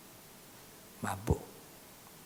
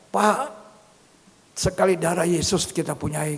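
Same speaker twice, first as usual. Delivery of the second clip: high, loud protest; insistent mother; 6 semitones higher, 8 dB louder than usual. Retold or imitated. Mother assuring the preacher of their faith, loud and high. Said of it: "Mamanya bilang".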